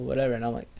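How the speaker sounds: ordinary delivery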